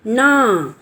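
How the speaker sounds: ordinary talk, nothing marked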